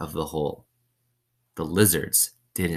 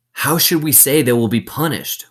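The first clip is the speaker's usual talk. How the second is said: higher and louder uncertain counselor; counselor asking; 8 semitones higher, 6 dB louder than usual. A narrator's reading. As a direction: ask for orders, raised. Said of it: "one of the counselors asked".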